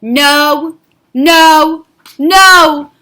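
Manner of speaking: loud angry refusal, each no punched; a stubborn king yelling no; Paro shouting no. Acted. Paro refuses Moshe, shouting angrily. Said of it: "Paro shouted".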